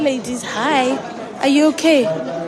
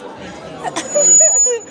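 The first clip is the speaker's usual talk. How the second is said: laughter; 11 semitones higher than usual. Real, not acted. Laugh during greetings.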